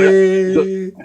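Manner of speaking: high, loud goofy pet voice; silly cat voice. Pretend. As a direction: give the cat a dopey high voice. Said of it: "Norbert's just like".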